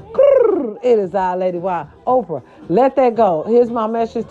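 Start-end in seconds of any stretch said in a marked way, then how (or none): 0.1-0.8 high-pitched rolled-tongue trill; catchphrase trill, pitched way up; high trilled "grrr". Real, not acted. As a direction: roll out a high playful trill. Signature trill before her catchphrase.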